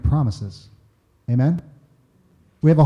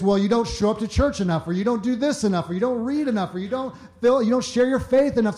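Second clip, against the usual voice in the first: mock nagging; 7 semitones higher than usual. Mocking critics' nagging list.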